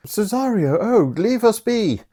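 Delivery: high, breathy-sounding noblewoman brushing people off; voicing Olivia. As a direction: shoo them away like a lady. Olivia voiced dismissing the others.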